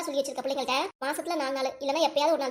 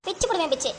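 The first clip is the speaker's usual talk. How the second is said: ordinary talk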